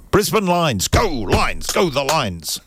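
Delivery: rally the Lions like a fan; host cheering on the Lions. Real, not acted. Radio host cheering for a footy team.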